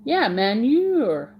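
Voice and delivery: slow "Yeah, manure" with "manure" stretched out; drawn-out manure. Shown as a demonstration. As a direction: drag the word "manure" out. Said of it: "I'm not saying manure".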